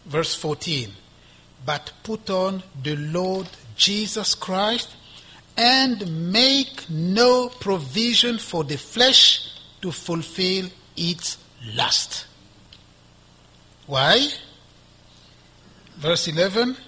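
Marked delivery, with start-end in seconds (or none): 1.7-12.2 slow, weighty proclamation of scripture; scripture reading. Acted. Preacher reads verse fourteen to the congregation, slow scripture reading. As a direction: draw the verse out solemnly, phrase by phrase.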